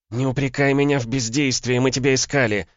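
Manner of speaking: high female voice, protesting; indignant defence. A narrator's reading. Woman saying they searched for him, indignant.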